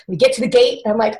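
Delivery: normal talking voice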